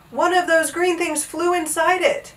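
high dismayed exclamation; gulped dismay. A narrator's reading. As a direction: report it in high-pitched dismay. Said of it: "he gulped".

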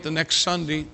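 ordinary talk, nothing marked

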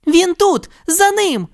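high urgent shout; Judy shouting. A narrator's reading. Judy shouts to chase the thief, urgent. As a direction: cry out to chase him. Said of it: "крикнула Джуді".

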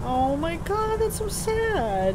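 high, drawn-out mock pity; mock sorrow. Reacting to the daughter's death in game.

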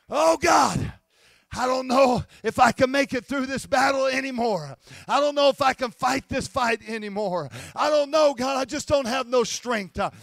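pleading prayer, pushed hard; desperate prayer. Acted. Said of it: "you're getting into a place of prayer".

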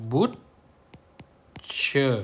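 "butcher" read with a clear final "cher", falling; teacher reads the word aloud for the class; model pronunciation. Shown as a demonstration. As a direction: say "butcher" slowly so pupils can copy it. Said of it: "เขาอ่านว่า".

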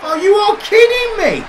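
furious disbelieving shout at the game; outraged shout. Real, not acted. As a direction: explode in disbelief. Outraged at a bad touch.

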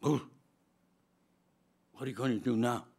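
usual speaking voice

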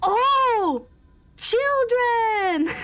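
high, long-drawn playful cry; mock calling out. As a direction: call out with stretched, swooping vowels.

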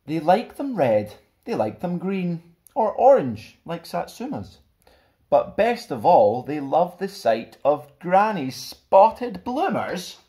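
put-on lively, bouncy picture-book narration for kids; animated rhyming read-aloud; storybook reading. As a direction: read it like a fun bedtime story to children. Reads picture book to children.

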